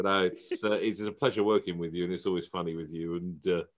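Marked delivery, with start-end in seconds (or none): none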